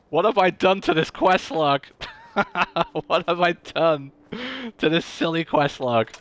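laughing through the words. Real, not acted. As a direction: chuckle while asking. Laughs at quest log.

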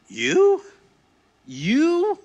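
high, loud, scornful cry of you; outraged shout. Acted. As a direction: shout it in disbelief at Jesus. Paul mocks Jesus, outraged.

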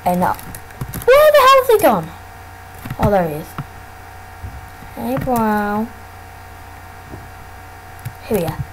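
normal talking voice

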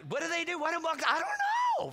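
high-pitched flustered rush; mock panic. City hunter face to face with bucks, mock panic. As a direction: climb to a squeal of comic alarm.

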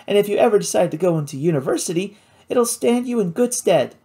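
put-on a lively, clever girl sharing her knowledge; Kate's voice. Kate advises university.

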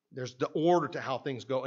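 usual speaking voice

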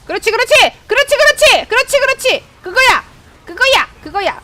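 high, loud cheering; cheering teammate's hit, high and loud; excited cheer. Real, not acted. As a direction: urge it on with joy. Cheering a good skill shot.

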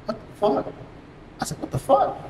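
high, half-whispered panic; retold startled whisper-squeak; alarmed hushed reaction. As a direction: squeak it softly so nobody outside hears. Caught off guard by knocking.

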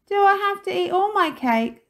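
high, loud whine of protest; protesting child. A narrator's reading. Jinx protesting over cake, high and loud.

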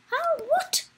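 very high squeak of disbelief; incredulous squeal; astonished. Real, not acted. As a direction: blurt it high and loud, baffled. Surprised at race result.

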